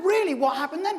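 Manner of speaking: high, breathless "really?"; eager listeners. Retold or imitated. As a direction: hang on every word. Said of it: "And they said".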